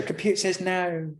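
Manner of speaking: high, falling mock jobsworth voice; mocking bored clerk voice; Computer says no impression. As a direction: deliver it like the Little Britain clerk. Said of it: "she can go".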